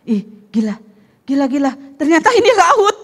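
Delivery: startled inner voice, half laughing; inner voice shocked. Retold or imitated. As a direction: gasp it out as if you just noticed the sea. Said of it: "kata hatinya bilang". (suara hati yang kaget sambil tertawa)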